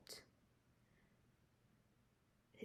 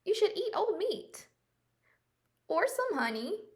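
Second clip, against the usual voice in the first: higher and louder, eager; 9 semitones higher, 4 dB louder than usual. Acted. An animal tells Jim what to eat, high and eager. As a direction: pitch it up, keen to help. Bear advice, voiced.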